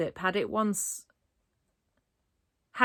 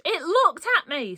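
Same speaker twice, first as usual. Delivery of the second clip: higher, louder shaken splutter; 10 semitones higher, 5 dB louder than usual. A narrator's reading. The boy stammering in shock at the eyeball. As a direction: blurt it out rattled. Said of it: "spluttered the boy".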